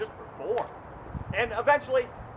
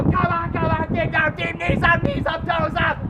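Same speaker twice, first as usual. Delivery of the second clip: higher, louder coaching calls; sideline yelling; 9 semitones higher, 3 dB louder than usual. Retold or imitated. Coach sprinting beside runners, yelling encouragement. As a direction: yell them down the track. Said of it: "Going nuts".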